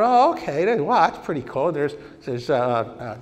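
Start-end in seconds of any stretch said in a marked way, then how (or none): 0.0-1.7 high, louder "oh" of wonder; student spotting the graphics computer; amazed. Retold or imitated. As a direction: gush like you just saw a marvel. Sees the graphics machine.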